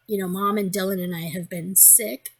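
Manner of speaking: ordinary talk, nothing marked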